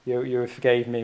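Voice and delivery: ordinary delivery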